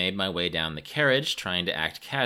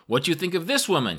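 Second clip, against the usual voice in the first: high, quick, loud question; yelled; 10 semitones higher, 5 dB louder, faster than usual. A narrator's reading. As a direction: yell it eagerly across the carriage. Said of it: "he yelled".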